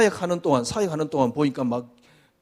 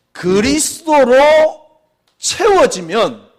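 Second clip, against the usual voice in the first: 그리스도로 loud and high, 채워지면 drawn out slowly; slow emphatic preaching; 10 semitones higher, 10 dB louder, much slower than usual. Real, not acted. Pastor stressing the key phrase to the congregation, slow and loud. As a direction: lean on each word, slow and loud.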